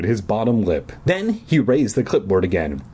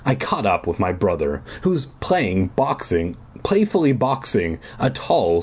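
ordinary delivery, nothing marked

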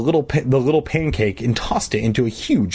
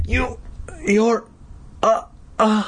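high, halting gasps; weak and faltering; 9 semitones higher, slower than usual. A narrator's reading. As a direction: falter as if barely able to speak. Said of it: "I stopped and coughed weakly".

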